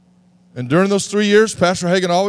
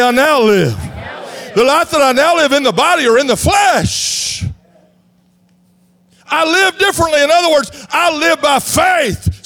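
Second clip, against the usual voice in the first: high, loud, driving sermon voice; rally the church out of passivity; shouted high preaching, slowing on the punchline; full-force preaching; 8 semitones higher, 4 dB louder than usual. Acted.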